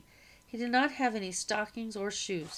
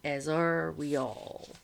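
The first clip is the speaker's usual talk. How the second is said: a slightly lower, grim afterthought; 3 semitones lower, slower than usual. A narrator's reading. Freed man adding a grim aside to the boy, slightly lower. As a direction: drop the pitch a little for the aside. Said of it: "adding in a lower voice".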